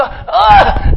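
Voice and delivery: high, loud mock shout; mock battle cry. Movie David yelling as he throws, mock shout. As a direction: yell as he lets the sling fly. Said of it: "he go".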